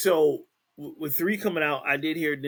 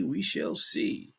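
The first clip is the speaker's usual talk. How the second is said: slow, teasing whisper; slower than usual. Shown as a demonstration. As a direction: breathe it slowly, mysterious. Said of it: "repeated take".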